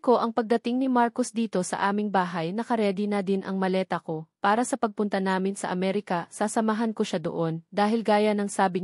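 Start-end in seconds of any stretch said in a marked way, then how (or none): none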